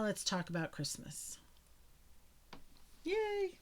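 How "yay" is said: excited cheer. Real, not acted. Cheer announcing Christmas.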